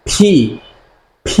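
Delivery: "P" released with a strong puff; stressed P. Shown as a demonstration. Teacher modelling the lip stress for learners, aspirated "P". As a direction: burst "P" from closed lips with audible air. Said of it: "একটা stress থাকবে".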